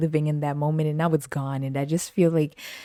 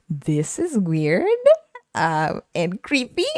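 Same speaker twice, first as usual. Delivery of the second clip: mock outraged; 8 semitones higher, much slower than usual. Mocking creepy neighbor quote.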